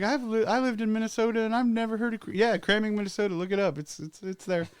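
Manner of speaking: put-on high, fast insistent girl voice; imitating a drunk girl insisting; quoting the girl. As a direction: rattle it off like a confident local. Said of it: "she's like".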